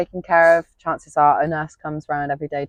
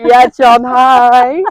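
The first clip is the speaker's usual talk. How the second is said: excited greeting; 9 semitones higher, 12 dB louder, much slower than usual. Retold or imitated.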